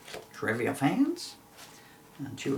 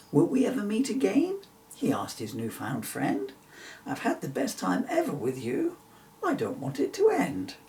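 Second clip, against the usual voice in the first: wistful friend; 7 semitones higher than usual. Acted. Bill's wistful goodbye.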